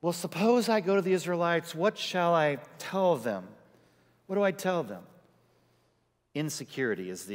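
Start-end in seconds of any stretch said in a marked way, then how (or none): 0.0-5.0 fretful Moses. Retold or imitated. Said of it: "Excuse number two, here it comes".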